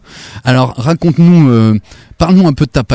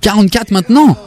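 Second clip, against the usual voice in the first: high astonished question; stunned at the shoe size; surprised; 9 semitones higher than usual. Real, not acted. Enfant annonce une grande pointure. (étonné)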